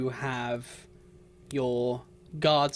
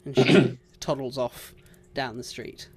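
usual speaking voice

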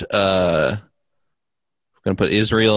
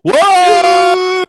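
big high whoa of surprise; surprised shout; 9 dB louder than usual. Real, not acted. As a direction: shout it out in shock. Hears podcast news, shouts.

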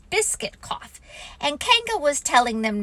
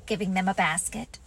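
plain reading voice

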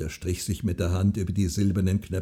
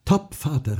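mildly called, higher-pitched agreement; 7 semitones higher, 3 dB louder than usual. A narrator's reading. Daughter seizes on her father's promise, calling out. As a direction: pipe up decisively, raised pitch. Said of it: "rief sie".

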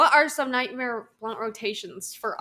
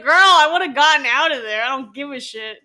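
loud exasperated reaction; 4 semitones higher, 10 dB louder than usual. Retold or imitated. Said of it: "I was like".

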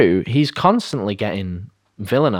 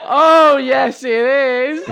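high, slow panto shout; panto crowd callback; 14 semitones higher, 4 dB louder, much slower than usual. Pretend. Panto call and response.

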